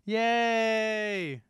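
high happy yay; cheer. Real, not acted.

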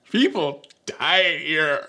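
slow, high mock-tearful outrage; hammed-up wail of indignation, drawn out; really mad. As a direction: imitate an angry, crying girl. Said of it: "she's really mad".

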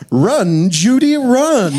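high, drawn-out urgent call; urgent line reading. Retold or imitated. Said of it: "a really fun line reading".

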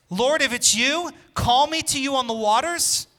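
loud, high call across the water, slowed; Peter calling out. Acted. Pastor voicing Peter calling loudly to Jesus on the waves. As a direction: cry out boldly to Jesus from the boat.

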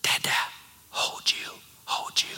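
put-on soft, small toddler whisper; tiny child pleading in the dark; little girl's voice. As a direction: whisper like a sleepy two-year-old. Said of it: "I hear this little voice that says".

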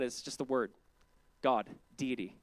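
ordinary delivery

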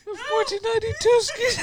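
high laughing echo. Real, not acted. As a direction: repeat it while cracking up. Echoing joke laughing.

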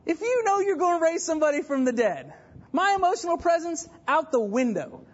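high-pitched, worked-up preaching; incredulous bravado. Real, not acted. Preacher imagining he could raise the dead, worked-up bravado. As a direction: argue it high and in disbelief.

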